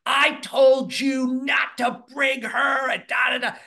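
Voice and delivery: retold screaming outburst, high and loud. Ex screaming at the girlfriend on the hike, a high loud shout. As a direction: yell it at her like she's about to attack. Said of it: "comes screaming at her".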